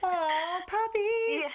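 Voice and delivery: high, delighted squeal at the word puppies; excited squeal. Real, not acted. Host hears guest has a puppy, high excited squeal.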